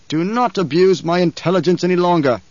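loud, high, imperious rage; roaring tyrant king. A narrator's reading. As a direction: dress down a cringing subject. Emperor rages at the Baron.

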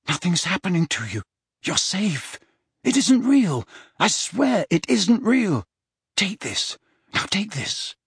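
convince him, then coax gently; worried, earnest soothing; urgent reassurance. A narrator's reading. Harry frantically reassures delirious Dumbledore.

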